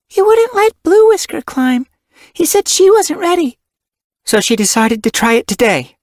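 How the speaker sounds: put-on young kit voice.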